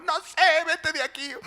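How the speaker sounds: high, huffy wife voice; sulky wife. Pretend. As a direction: push him away, pouting. (esposa enfurruñada con voz aguda)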